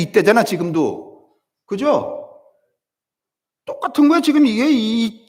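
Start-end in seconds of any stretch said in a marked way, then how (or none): none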